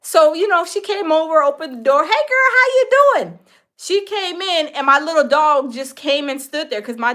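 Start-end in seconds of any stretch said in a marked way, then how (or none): 2.0-3.4 put-on high, bright-eyed hello in a girlfriend's voice; imitated cheery friend. Friend arriving at the door, high cheery hello. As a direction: pitch it up high and chirp the greeting.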